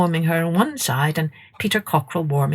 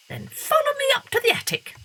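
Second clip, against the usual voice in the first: much higher, quick, softer bat voice; squeaky bat; 3 dB quieter, faster than usual. A narrator's reading. Bat leading Masha to the attic. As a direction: squeak it briskly.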